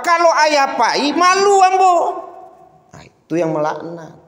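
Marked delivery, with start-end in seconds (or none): 0.0-1.9 rising to a high shout on malu ambo; inner outburst. Retold or imitated. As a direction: blurt out the hidden shame. Child's inner thought exclaimed. (anak berteriak dalam hati "malu ambo")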